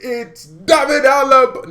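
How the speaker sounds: put-on loud, high Nigerian accent. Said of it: "I am going to bring my Nigerian accent".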